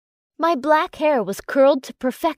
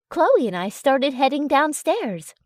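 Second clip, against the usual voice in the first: plain reading voice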